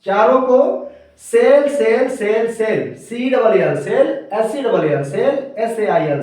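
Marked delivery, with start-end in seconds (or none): 0.1-2.1 सेल said louder and higher, stressed; teacher stressing सेल louder and higher; emphatic sell. Shown as a demonstration. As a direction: hammer home that each सेल sounds the same. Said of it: "pronunciation चारों का same है".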